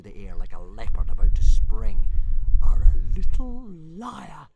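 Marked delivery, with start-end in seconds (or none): 2.6-4.5 slow, menacing hiss. Acted. Fireworm hissing at Toothless, slow and menacing. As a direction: draw it out like a threat. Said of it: "hissed Fireworm".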